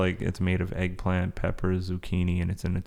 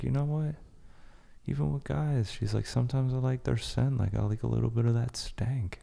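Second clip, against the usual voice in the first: lightly voiced, higher quoted Amber Rose; a hint of a candid confession, pitched up; quoting Amber Rose; 5 semitones higher, 5 dB quieter than usual.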